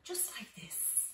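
soft unvoiced whisper; whispered demonstration to the children. Shown as a demonstration. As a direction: hush it as you show the cocoon. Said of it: "around and around".

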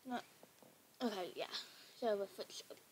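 ordinary delivery

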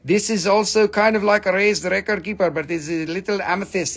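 usual speaking voice

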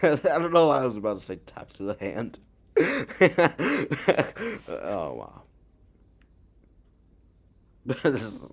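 laughing hard mid-sentence. Real, not acted. Reacting to the video, bursting into laughter. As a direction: crack up while saying it.

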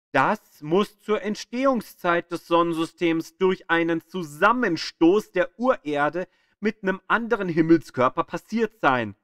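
ordinary talk, nothing marked

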